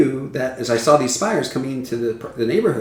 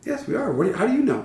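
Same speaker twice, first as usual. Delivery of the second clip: quoting Roger; 8 semitones higher, 3 dB quieter, faster than usual. Retold or imitated. Quoting Roger with English accent.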